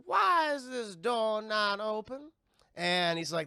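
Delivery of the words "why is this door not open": high, slow Southern questioning; Zelda's high voice. Retold or imitated. Seer questioning father about a closed door, high Southern drawl. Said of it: "very high voice and everything".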